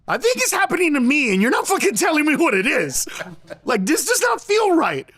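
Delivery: high-pitched, indignant protest; exasperated retort. Retold or imitated. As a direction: throw the complaint back in exasperation. Acted exasperated retort to crying partner.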